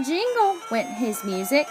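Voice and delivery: ordinary delivery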